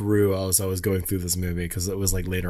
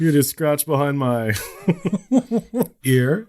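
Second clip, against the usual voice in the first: higher put-on creature voice breaking into giggles; creepy dragon impression; 5 semitones higher, 3 dB louder, slower than usual. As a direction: play the creepy dragon, then crack up. Mimicking Falkor, breaking into laughter.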